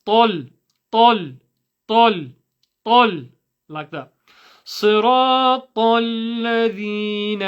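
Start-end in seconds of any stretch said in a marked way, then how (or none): 0.0-3.2 "tal" punched out louder, no w glide; forceful, separated "tal" with a dark vowel; still-lipped heavy t. Shown as a demonstration. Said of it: "You just move your tongue". 4.6-7.4 higher, slow chant; measured teaching recitation; Quranic recitation. Shown as a demonstration.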